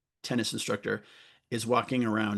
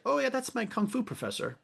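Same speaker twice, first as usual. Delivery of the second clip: much higher, breezy offhand mock; mock blasé; 11 semitones higher than usual. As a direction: toss it off as if nothing happened. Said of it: "totally blasé is like".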